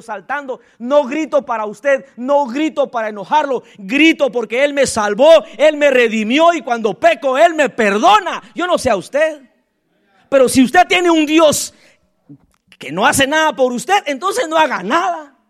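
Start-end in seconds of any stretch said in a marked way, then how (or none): 0.8-8.4 loud joyful preaching; shouting praise. Real, not acted. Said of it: "me ve aquí gritando". 10.3-15.4 loud, driving sermon; fiery preaching. Real, not acted. Preaching at full force.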